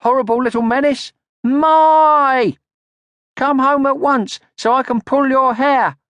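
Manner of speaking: high, loud scolding yell. A narrator's reading. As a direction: yell at the hiding little sister. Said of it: "she yelled".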